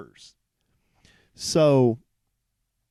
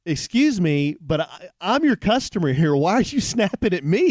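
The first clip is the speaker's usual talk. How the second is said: raised, higher, louder protest; man retelling how he scolded a curt server, indignant and raised; indignant retort; 7 semitones higher, 5 dB louder than usual. Retold or imitated. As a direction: push back hard at the rude waitress. Retelling confronting rude server.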